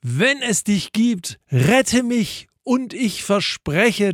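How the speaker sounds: high-pitched storyteller's plea; narrated desperate appeal, high and drawn out; pleading cry. A narrator's reading. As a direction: beg to be rescued, stretching it out. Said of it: "schrie er noch einmal".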